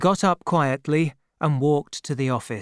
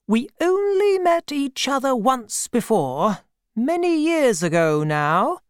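higher voiced brisk old aunt; a kindly, no-nonsense old aunt greeting an old acquaintance; elderly aunt; 9 semitones higher than usual. A narrator's reading. Aunt greeting Peggotty, higher brisk aunt voice. Aunt's voice, held.

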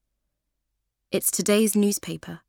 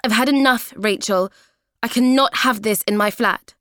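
loud, higher exasperated scolding; fed-up anger; 4 semitones higher, 7 dB louder than usual. Acted. Kathy telling Rachel off for the mess, angry. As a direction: lay down the law.

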